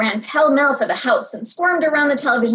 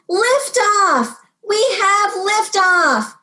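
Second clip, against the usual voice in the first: much higher, slow, triumphant call; excited liftoff; 9 semitones higher, much slower than usual. Pretend. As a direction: announce the launch like mission control, thrilled. Child announces rocket launch.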